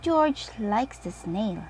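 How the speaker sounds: ordinary delivery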